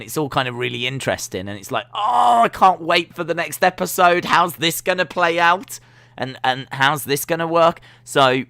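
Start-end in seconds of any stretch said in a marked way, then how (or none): 1.9-7.8 much higher and louder, thrilled; excited viewer. Retold or imitated. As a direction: gush like a hooked binge-watcher.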